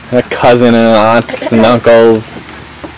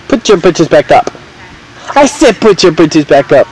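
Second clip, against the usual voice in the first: playful scolding; 5 semitones higher than usual. Real, not acted.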